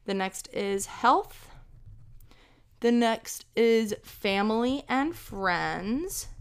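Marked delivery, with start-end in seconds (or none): none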